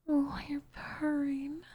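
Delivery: delighted whisper. Acted. Witch charmed by purring cat.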